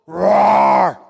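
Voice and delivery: huge, shouted lion roar; preacher roars like a lion to startle the antelope. Pretend. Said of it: "he goes".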